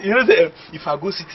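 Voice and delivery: ordinary talk, nothing marked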